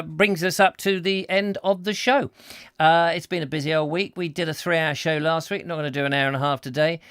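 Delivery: ordinary talk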